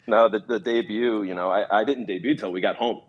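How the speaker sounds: normal talking voice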